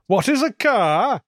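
high, slow puzzled period character; mock reenactor. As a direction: play dumb like a Tudor actor. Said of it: "And they go".

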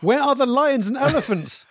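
put-on high West African accent, slow, laughing through it; heavy West African accent. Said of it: "in a heavy West African accent".

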